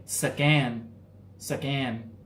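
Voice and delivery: "Scan" in a Thai accent, split into "suh-KAN". Shown as a demonstration. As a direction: say "Scan" Thai-style, with a vowel between s and c. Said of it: "I say it with the Thai accent".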